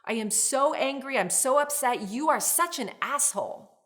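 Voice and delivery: loud, high, heated blaming; angry outburst. Shown as a demonstration. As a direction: snap at your partner in anger. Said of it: "Emotions driving is when you say something like".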